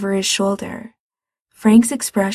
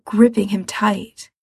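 plain reading voice

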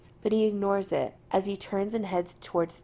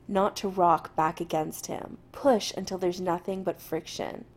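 ordinary delivery

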